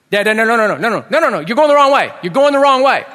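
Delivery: high, loud acted kid's yelling; hammed-up child's alarm, repeated and urgent; panicked protest. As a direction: shout frantically to stop Dad. Kids protest dad's wrong turn.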